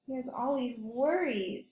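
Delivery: usual speaking voice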